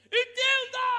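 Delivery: sudden high shout; commanding cry. Acted. Preacher ordering the church to understand, loud and high. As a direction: bark the command at the congregation.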